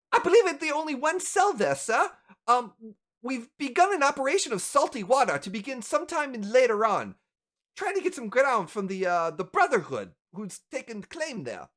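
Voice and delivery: high-pitched, deferential junior assistant; young assistant. Acted. Assistant handing the elf a parcel, young assistant voice. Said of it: "a young assistant comes in".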